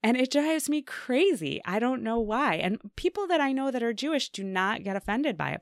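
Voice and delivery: high, riled-up rant; exasperated. Real, not acted. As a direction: let the annoyance pour out. Venting annoyance.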